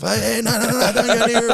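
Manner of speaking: very high, frantic mock warning; security guard warning. Guard shooing fans off the players, high and frantic. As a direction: yelp it as a comic warding-off. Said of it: "telling people to fuck off".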